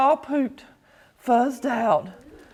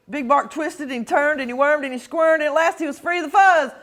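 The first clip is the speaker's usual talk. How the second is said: usual speaking voice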